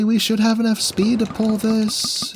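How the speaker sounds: ordinary talk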